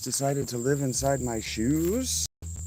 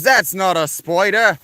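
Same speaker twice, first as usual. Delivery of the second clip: higher, louder, slow Aussie scoff; mock Australian; 7 semitones higher, 6 dB louder than usual. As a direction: imitate the smug chat commenter. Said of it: "this fucking Australian guy gets in my chat and goes".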